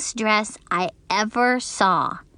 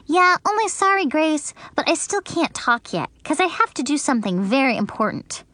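put-on higher childish voice, rushing; hurried little girl; 6 semitones higher, faster than usual. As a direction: hurry through the excuse. Junie B's voice in the story.

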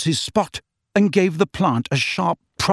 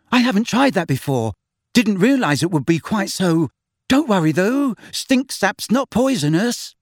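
nervous; 7 semitones higher than usual. A narrator's reading. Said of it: "he added nervously".

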